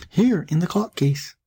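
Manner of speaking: put-on high, slow child's call; small high kid voice; little voice. Youngest kid calls from the clock case, little high voice. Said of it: "a little voice cried".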